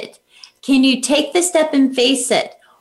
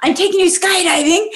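mock panic; 7 semitones higher than usual. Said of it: "I'm, like, panicking, going".

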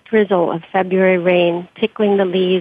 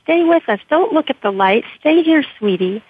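higher, raised pleading call; urgent yell; 6 semitones higher than usual. Acted. Therapist calling the patient back from the light, urgent yell. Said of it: "A respiratory therapist working on her yelled".

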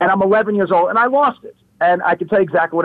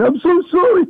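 high, louder tearful plea; imitating a sobbing kid saying sorry; boy who cursed apologizing in tears, mock sob; retold crying apology; 12 semitones higher, 4 dB louder than usual. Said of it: "and I'm crying".

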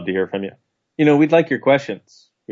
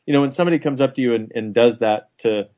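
ordinary talk, nothing marked

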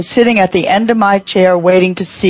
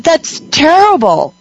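high, drawn-out mock dismay; appalled reaction; 9 semitones higher, slower than usual. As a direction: re-enact being appalled, slowly and high. Acting out own shocked reaction.

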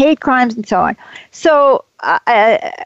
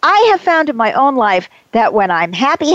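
ordinary talk, nothing marked

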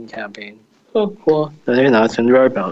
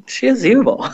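laughing; 12 semitones higher than usual. Real, not acted. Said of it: "laughing while calling her evil".

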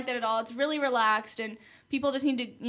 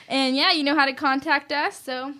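ordinary delivery

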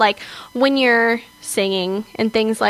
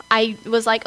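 normal talking voice

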